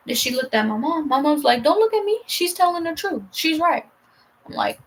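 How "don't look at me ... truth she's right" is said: high-pitched retold mom, brushing off an appeal; imitating mom. Mom refusing to back the sister, high-pitched imitation. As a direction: act out mom's high, insistent reply. Voices mom backing her up.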